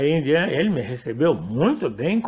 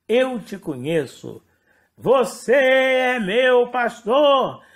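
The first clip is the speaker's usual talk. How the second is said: imitating a shouted proclamation; slower than usual. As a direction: proclaim it for everyone to hear, stretching the words out. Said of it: "mas ela falou gritando, gritando pra todo mundo ouvir". (imitando uma proclamação gritada)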